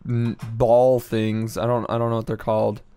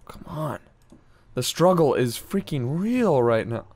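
normal talking voice

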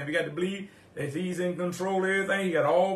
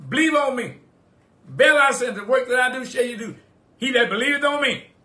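preaching at full force; 7 semitones higher, 8 dB louder than usual. Acted. Pastor proclaiming Jesus's words to the church, full-force preaching. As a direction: proclaim it like thunder from the pulpit.

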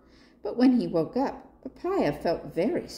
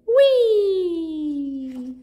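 high, loud gleeful squeal; joyful sliding cry; excited whee; 9 semitones higher, 8 dB louder than usual. Acted. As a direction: shout it like a kid on a slide. Giraffe slide, excited cry.

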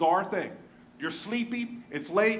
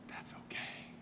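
whispered and much softer, tender; whispered reassurance; 18 dB quieter, slower than usual. Real, not acted. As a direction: breathe it gently to someone hurting. Tender reassurance to hurting listener.

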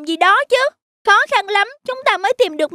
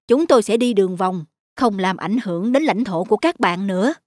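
calm elder elephant; 9 semitones lower than usual. Acted. Lead elephant apologising to the field mice, low and gentle. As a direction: speak low and sorry to the mice.

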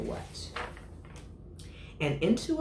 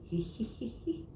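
high, soft giggle; a little giggle rising up; giggling he he; 9 semitones higher, 6 dB quieter than usual. Acted. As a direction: elephant giggling in disbelief. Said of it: "He began to giggle".

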